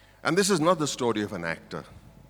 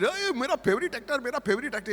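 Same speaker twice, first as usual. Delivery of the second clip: higher, quick gushing fan voice; excited fan; 11 semitones higher, much faster than usual. Retold or imitated. Fan gushing over the actor, lightly excited. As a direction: gush like a starstruck fan. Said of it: "He got damn excited".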